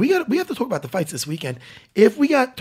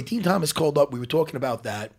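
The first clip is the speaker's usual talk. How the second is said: normal talking voice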